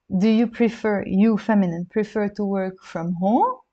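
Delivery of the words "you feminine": ordinary talk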